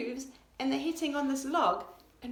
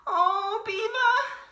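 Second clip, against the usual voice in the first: higher, louder sighing plea; sighing deer; 11 semitones higher, 7 dB louder, much slower than usual. A narrator's reading. Deer sighs to Beaver, high and sorry. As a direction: sigh his name up high. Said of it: "sighed Deer".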